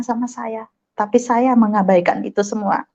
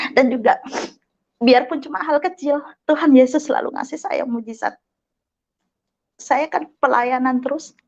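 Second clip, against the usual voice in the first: ordinary talk, nothing marked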